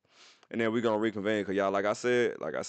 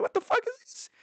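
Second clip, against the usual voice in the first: much higher, a squeaky stunned cry; shocked disbelief. Retold or imitated. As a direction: squeal it like you can't believe the screen. Shocked at ninety-nine Tinder matches.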